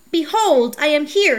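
loud, high proclamation; cried out. A narrator's reading. Uncle bursting into the wedding feast, loud cry. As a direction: announce yourself loudly. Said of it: "he cried".

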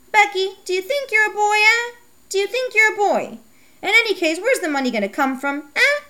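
scolding mother. Acted. Mom scolding Becky for wanting a bike, high and exasperated. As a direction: play a sharp, fed-up mother.

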